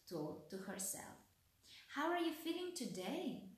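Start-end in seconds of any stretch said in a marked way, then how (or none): 1.9-3.5 lightly voiced girl, high questioning lilt; little girl. A narrator's reading. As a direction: ask it in a lightly raised girlish voice. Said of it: "the little girl asked as she tiptoed".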